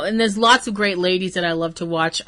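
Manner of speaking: normal talking voice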